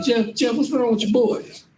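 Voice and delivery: high, bemused mock question; puzzled supervisor. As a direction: ask a coworker what's up with his friend. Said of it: "He gonna be like".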